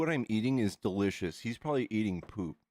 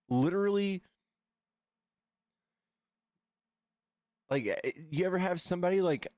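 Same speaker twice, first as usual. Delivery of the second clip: indignant rant; 7 semitones higher than usual. Real, not acted. Ranting indignantly to chat.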